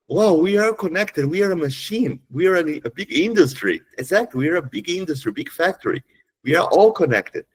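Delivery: high-pitched, amazed exclamation; excited announcement. Acted. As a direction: share a big discovery with wonder, pitched high. Acts out excited message to people.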